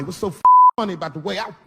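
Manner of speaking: loud, fast indignant rant; angry. Retold or imitated. Friend demanding an answer, loud and fast. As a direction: fire it off, offended. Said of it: "He said".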